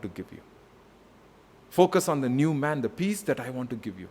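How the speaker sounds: God's gentle voice. Acted. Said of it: "And then he says".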